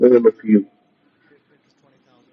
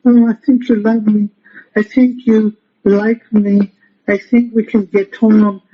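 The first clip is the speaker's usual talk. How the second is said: higher, pleading and unsure; pity voice; 5 semitones higher than usual. Shown as a demonstration. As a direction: whimper it like begging to be loved. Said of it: "You don't just say with a pity voice".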